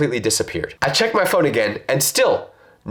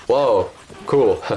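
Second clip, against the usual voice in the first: unbothered; 4 semitones lower than usual. Shown as a demonstration. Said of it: "react like this to a jump scare".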